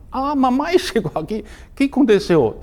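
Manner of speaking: surpresa aguda e animada; espanto, bem mais agudo. Retold or imitated. Filho ouve voz da mãe. (filho ouve a mãe chegando, surpreso)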